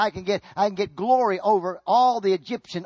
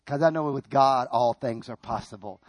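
low, softened, settled conviction; hushed, certain faith after the outburst; calm assurance; 9 semitones lower, 5 dB quieter than usual. Acted. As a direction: drop low and quietly reassure. Answers despair with calm assurance.

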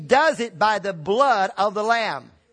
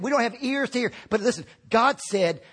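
normal talking voice

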